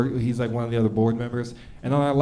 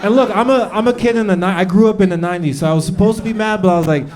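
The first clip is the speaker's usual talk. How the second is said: ordinary talk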